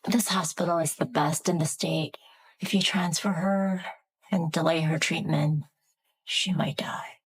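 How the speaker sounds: hushed warning; whispering. Acted. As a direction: whisper the grim news. Doctor speaks in hushed tones.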